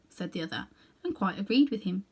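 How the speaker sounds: plain reading voice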